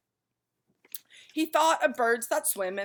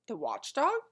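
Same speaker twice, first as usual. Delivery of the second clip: high questioning rise on watchdog; puzzled, asking it back; quizzical; 9 semitones higher than usual. A narrator's reading. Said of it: "said Milo quizzically".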